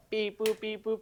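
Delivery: robot voice. Pretend. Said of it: "It's 'cause your robotic voice".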